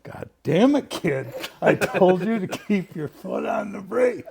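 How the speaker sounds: high, loud mock exasperated scolding; hammed-up angry operator; imitating George cursing. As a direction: curse at the kid for the dropped bucket. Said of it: "He'd turn around and look".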